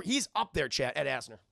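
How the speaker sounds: ordinary delivery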